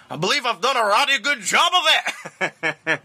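high-pitched Scottish husband punchline; Scottish accent. Acted. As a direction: land the punchline in hammed-up Scottish. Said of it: "he does it in a Scottish accent".

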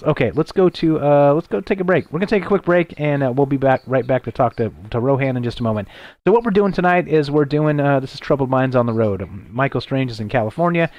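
ordinary talk

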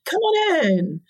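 high, bright beckoning call; calling out invitingly. Retold or imitated. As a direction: wave them in from the water. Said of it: "All of us on this side are going".

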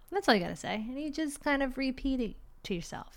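ordinary talk

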